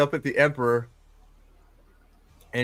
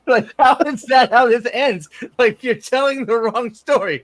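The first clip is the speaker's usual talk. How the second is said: high, loud outraged protest; outraged disbelief; 8 semitones higher, 5 dB louder than usual. Real, not acted. Fan protesting the show's ending to a friend, outraged. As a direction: protest like they ruined it. Ranting about the show ending.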